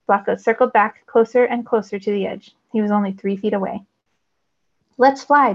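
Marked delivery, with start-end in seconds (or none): 5.0-5.5 higher, louder eager shout. A narrator's reading. Brooks urging the group to leap, shouting. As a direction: call it out bold. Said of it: "Brooks shouted".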